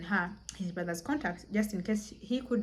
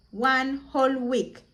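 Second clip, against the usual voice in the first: slow, higher, louder: one whole week; stressed repeat; 4 semitones higher, 4 dB louder, slower than usual. Retold or imitated. Storyteller stressing the long silence, slow and emphatic. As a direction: lean on every word of the repeat.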